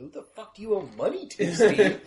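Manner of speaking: high-pitched, hammed-up exasperation; exasperated demand. Van driver hauling Steve out over his debts, exasperated demand.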